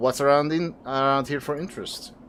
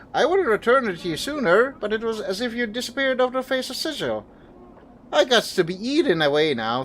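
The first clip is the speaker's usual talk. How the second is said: put-on higher, eager reunion voice of an old pal; old friend glad to see you again, voiced higher; surprised old friend; 8 semitones higher than usual. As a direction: greet a long-lost friend with higher delight. Voices excited old friend NPC.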